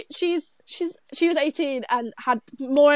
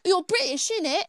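higher, hammed-up British accent; caricature British accent; 6 semitones higher than usual. Mocking how the attempt sounds, caricature British accent. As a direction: ham up a cheeky British accent. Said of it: "That's what it sounds like".

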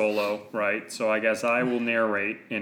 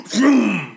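vroom sound; 9 semitones higher, 9 dB louder than usual. Acted. Sound effect of lightsaber.

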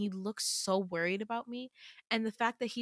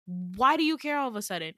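louder, sharp challenging question; angry snap; 4 semitones higher, 5 dB louder than usual. Acted. Girl to Damien who suddenly acts nice, angry snap. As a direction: snap back, hitting the words hard. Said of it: "snap at him".

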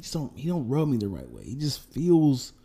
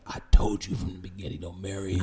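told-you-so friend; 8 semitones lower, 3 dB quieter, faster than usual. Acted. Acted friend says told-you-so.

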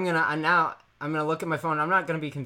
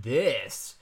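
grand presentation; 4 semitones lower, much slower than usual. Shown as a demonstration. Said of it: "we've covered".

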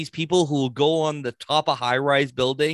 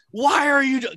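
high-pitched mock panic; retold freak-out; 8 semitones higher than usual. Friend imitating panicked rider on the Ferris wheel, mock panic. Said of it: "He's freaking the fuck out. And he's going".

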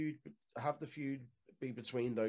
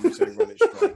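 laughing squeak; 15 dB louder than usual. Real, not acted.

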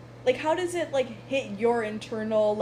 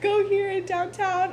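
laughing; 9 semitones higher than usual. Real, not acted. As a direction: crack up while correcting yourself. Cracking up at her slip.

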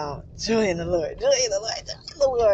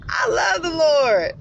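louder and higher, crying it out with joy; excited shout; 6 semitones higher, 5 dB louder than usual. Real, not acted. Worshipper declaring her love for the Lord to viewers, joyful shout. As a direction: proclaim it with overflowing joy. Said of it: "I'm getting excited".

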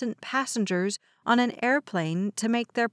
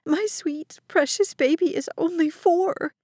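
sobbing mother; 8 semitones higher than usual. A narrator's reading. Terrified mother sobs while clutching her son, wailing. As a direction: cry out over her baby.

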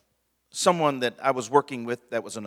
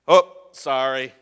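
careless sorry; 8 dB louder than usual. Shown as a demonstration. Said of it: "that's all sorry does".

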